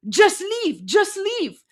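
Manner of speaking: high mock chant of leave; imitating the crowd, high and insistent; a high, hammed-up crowd chant; mock protesters shouting. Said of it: "they're like".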